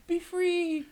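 very high, drawn-out playful call; mock farewell. Host setting the lost thought free, high mock farewell. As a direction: release it in a tiny high voice.

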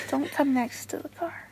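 hushed whisper at a stranger. Real, not acted. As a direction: whisper so he won't hear. Whispering at passerby.

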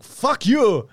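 loud, high mock yell; imitated yelling. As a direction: imitate a movie character's angry outburst. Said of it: "like, yelling at somebody".

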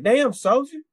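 high-pitched Southern sergeant impression; mock Southern sergeant exclamation; Southern first sergeant.

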